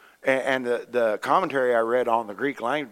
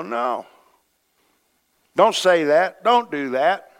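firm, raised voice of correction; God interrupting; 5 semitones higher, 6 dB louder, slower than usual. Acted. God breaking into someone's thoughts, firm and raised. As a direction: cut in with pitched-up, emphatic commands. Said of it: "God will break into your thoughts sometime and go".

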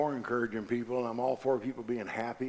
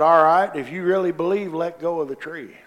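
booming voice of God; 5 semitones higher, 8 dB louder than usual. Acted. The Lord speaks in joke.

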